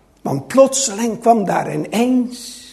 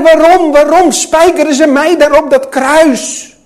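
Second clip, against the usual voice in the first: loud, higher anguished outcry; raised, indignant pleading; crying out in protest; 4 semitones higher, 6 dB louder than usual. Acted. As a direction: protest your innocence at full voice. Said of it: "Riep hij".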